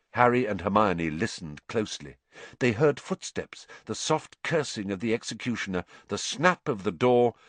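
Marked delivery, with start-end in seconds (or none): none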